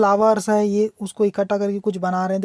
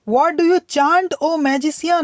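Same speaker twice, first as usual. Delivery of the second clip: poem reading; 6 semitones higher, much slower than usual. Shown as a demonstration. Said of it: "teacher reads poem line aloud".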